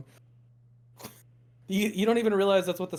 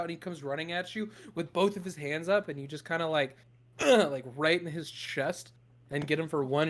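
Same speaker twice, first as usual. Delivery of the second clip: ordinary talk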